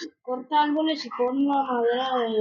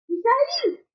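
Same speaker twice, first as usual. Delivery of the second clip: calling out; 11 semitones higher, much slower than usual. Real, not acted. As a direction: shout his name high. Calling out to friend.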